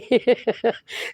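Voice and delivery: trailing giggle after a big laugh; amused leftover laughter; real chuckle. Real, not acted. Still amused after explaining.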